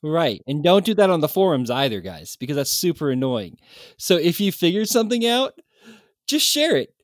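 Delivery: loud, exasperated forum rant; mock rant. Shown as a demonstration. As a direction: scold the listeners in jest. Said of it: "Of course, I was kidding, uh, but just giving everybody an example of what not to do".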